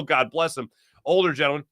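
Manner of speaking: ordinary talk, nothing marked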